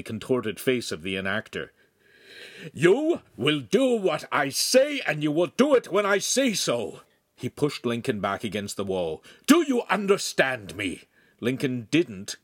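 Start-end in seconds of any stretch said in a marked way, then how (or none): 2.7-7.0 angry command. A narrator's reading. Master grips choking apprentice, angry command. 9.5-11.0 raised, high-pitched demand; angry demand. A narrator's reading. Master pins apprentice to the wall, angry demand.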